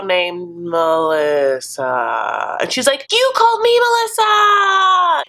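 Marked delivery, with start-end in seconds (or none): 0.0-2.6 mock-reluctant, stretching the name out slowly; dragged-out confession. Recapper imitating him naming the other girl, dragged-out reveal. 3.1-5.2 high, loud, stretched mock shriek; outraged shriek. Recapper voicing the girlfriend hearing another name, outraged shriek.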